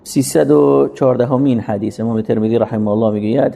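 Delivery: ordinary delivery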